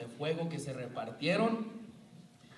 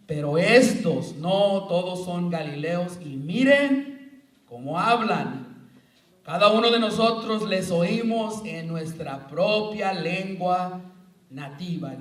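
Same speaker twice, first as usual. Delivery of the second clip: slow, louder reading of the crowd's amazement; scripture reading; 5 dB louder than usual. Acted. Preacher reading Acts aloud to the congregation, astonished.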